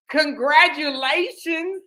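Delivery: high, drawn-out singsong cheer; gushing congratulations. Retold or imitated. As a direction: gush it like delivering happy news. Voices the lady's cheerful news.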